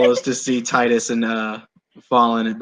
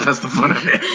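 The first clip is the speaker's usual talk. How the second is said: imitating voice; faster than usual. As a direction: parrot the line back in a put-on voice. Player repeats the line in a put-on voice.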